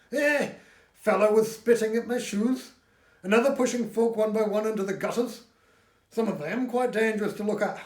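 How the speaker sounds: high, loud outraged Northern voice; Dixon complaining. A narrator's reading. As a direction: burst out in loud indignation. Dixon's outraged Geordie-flavoured complaint.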